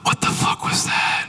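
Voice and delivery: slow, voiceless gasp of alarm; alarmed whisper. Retold or imitated. Businessman hit by first raindrop, slow spooked whisper. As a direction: whisper it slowly as if danger is near.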